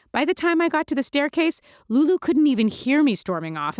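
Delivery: plain reading voice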